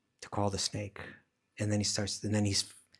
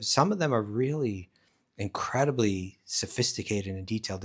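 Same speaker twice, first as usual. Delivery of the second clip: ordinary talk, nothing marked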